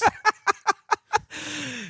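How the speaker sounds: high burst of laughter; real laugh. Real, not acted. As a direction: crack up at a friend's joke. Cracks up at the joke.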